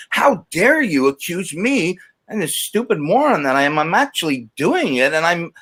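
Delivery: high-pitched indignant outrage, acted out in retelling; really mad, retold. Addict recalling outrage at mom's accusation, really mad. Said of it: "and I got really mad".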